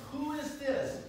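high, scandalized mock outrage; indignant Pharisee quote, lightly hammed.

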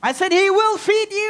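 high-pitched insistent declaration; emphatic preaching. Real, not acted. Preacher repeating the promise to church, emphatic preaching.

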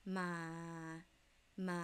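"ma" held straight on a low pitch. Shown as a demonstration. Teacher demonstrating Thai low tone, "ma" low and straight. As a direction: keep "ma" low and level from start to end. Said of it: "So the low part would be".

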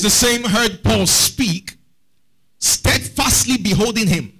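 slow, drawn-out reading of the verse; scripture reading. Real, not acted. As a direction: read the Bible verse slowly, with weight. Preacher reading scripture aloud.

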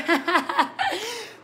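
a bright burst of real laughter; short laugh. Real, not acted. Daughter laughing about her mom's success, real laugh. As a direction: laugh at your own joke about mom.

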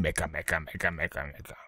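sinking mumbling grumble; muttered mock grumble. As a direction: mutter the complaint away under your breath. Muttered repetition fading.

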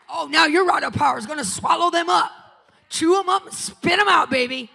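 loud, rising preacher shout over music; preaching at full force. Acted. Preacher to the congregation, full-force shouting sermon.

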